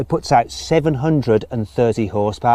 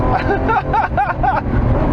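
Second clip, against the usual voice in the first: laugh. Real, not acted. Laughing while driving the car.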